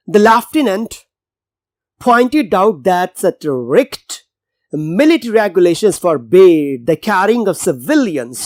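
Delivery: very slow, clear reading of the English line; slow text reading. Shown as a demonstration. Teacher reading the passage aloud, slow and careful. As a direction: read it word by word for the class.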